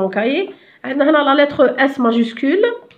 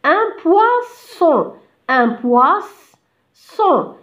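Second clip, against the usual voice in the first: "poisson" drawn out slowly in two syllables; teacher breaking "poisson" into "poiss" and "son"; split into syllables; 4 semitones higher, much slower than usual. Shown as a demonstration. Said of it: "ونقسمو اون دو سيلاب".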